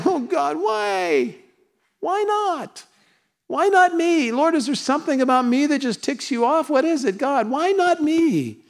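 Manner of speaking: high, whiny mock complaint; mock whining. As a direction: whine at God like a spoiled child. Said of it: "with no whining and no whying".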